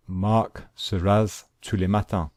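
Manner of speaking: "Marc se rase tous les matins" spoken in French; French sentence. Shown as a demonstration. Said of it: "in French, we say".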